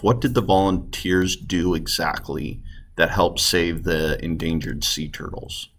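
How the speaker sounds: normal talking voice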